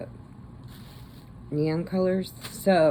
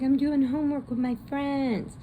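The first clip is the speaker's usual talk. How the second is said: put-on teen daughter; 11 semitones higher than usual. Teen daughter voiced in story.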